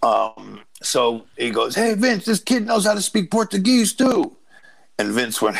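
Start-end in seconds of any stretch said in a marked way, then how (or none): 1.8-4.3 lightly voiced excited older man, much higher, slowing at the end; mimicking an excited agent. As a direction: call out to the boss like an eager old agent. Agent calls over to the boss.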